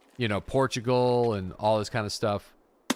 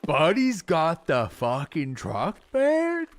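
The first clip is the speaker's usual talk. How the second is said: slow, louder mock Canadian, ending in a sharp high "man"; hammed-up prairie Canadian question with a squeaky high end; prairie Canadian accent; 6 semitones higher, 5 dB louder, much slower than usual. Imitating a prairie local asking about a truck, in a slow, exaggerated Canadian accent. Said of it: "You're gonna get a lot of that".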